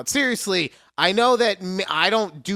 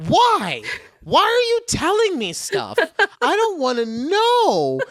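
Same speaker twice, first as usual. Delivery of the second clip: high-pitched, loud mock plea; exasperated; 13 semitones higher, 6 dB louder than usual. Worker dumped with coworkers' secrets, exasperated. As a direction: wail it in comic disbelief. Acting out exasperated reply to confiders.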